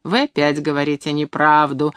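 usual speaking voice

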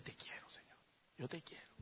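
soft whispered confession; ashamed whisper. Acted. Peter whispering to the Lord, ashamed.

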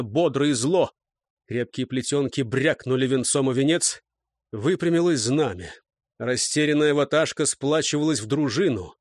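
ordinary delivery

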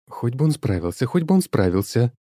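high quick worried repeat; browed girl fretting over Semyon; anxious chatter. A narrator's reading. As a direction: gabble it nervously. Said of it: "тараторила бровастая".

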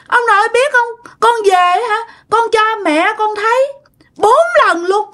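put-on high, pleading child close to tears; dead child telling grandpa how she struggled; child's voice. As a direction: beg grandpa like a small child, voice breaking. Spirit child tells her story.

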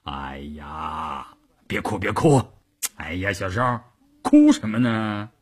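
low, soft coaxing with a sighing start; soothing. A narrator's reading. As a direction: gently hush someone who is crying. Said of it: "关山林忙说". (低声哄劝别哭)

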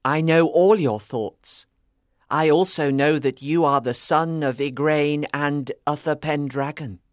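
put-on knowing boy, low and pointed; sharp small boy. A narrator's reading. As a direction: tell him sharply what you know. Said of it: "the boy said sharply".

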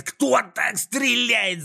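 very high, slow exasperated shriek; drawn-out shrill outrage; irritated yell. A narrator's reading. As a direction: screech each word in disbelief. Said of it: "заорал с раздражением Клопс".

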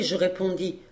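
ordinary delivery, nothing marked

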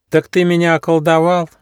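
put-on high astonished child voice; little hedgehog voice. A narrator's reading. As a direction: ask it wide-eyed. Hedgehog character voice.

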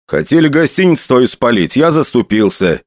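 usual speaking voice

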